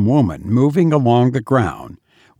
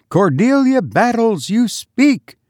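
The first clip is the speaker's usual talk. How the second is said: high-pitched, commanding scolding; peremptory; 11 semitones higher than usual. A narrator's reading. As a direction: order the frightened maid to answer. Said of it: "who could be peremptory".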